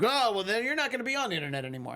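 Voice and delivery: high, fast mocking official; mocking Chinese officials. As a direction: toss off a snippy brush-off. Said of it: "they're just like".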